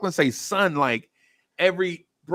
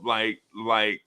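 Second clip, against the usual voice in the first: ordinary delivery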